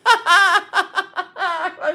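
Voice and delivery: high, drawn-out laughter over the words; can't stop cracking up; laughing hard. Real, not acted. Telling the audience the client's story while laughing. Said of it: "I was fucking laughing".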